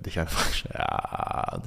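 under the breath, "Dich" muttered to himself; a sheepish self-correcting whisper; quiet self-mocking aside about "Dich". Real, not acted. Whispered aside about own mistake.

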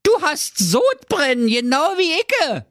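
high, daft Berlin prince voice; silly prince. Acted. Prince diagnoses the dragon's heartburn, high daft voice. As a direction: play the dopey prince, high.

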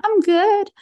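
high bright tone. Shown as a demonstration. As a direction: pitch "I'm good" up high and lift the end. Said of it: "the difference between them".

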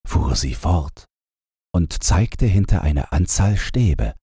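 plain reading voice

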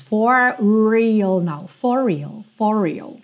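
"For real" joined on one curled R; single curled R. Shown as a demonstration. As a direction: curl the tongue only once. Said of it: "o mesmo R do real". ("for real" com um só R enrolado)

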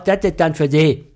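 curt imitation of an unfriendly cashier; snippy cashier. Cashier handing back to an unwelcome customer, snippy imitation. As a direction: hand it over dismissively, mimicking her. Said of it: "sagt sie dann ganz pampig".

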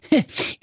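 high cocky chuckle; smug laugh. Acted. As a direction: laugh like a show-off. (selbstgefälliges Lachen)

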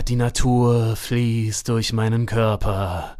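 slow, low, dreamy mock-poet recital; low and slow parody of pretentious improvised verse; mocking the bad lyrics. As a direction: drag it out like a self-absorbed stage poet. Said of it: "so eine Texte wie".

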